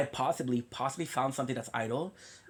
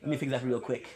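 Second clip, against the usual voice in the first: ordinary delivery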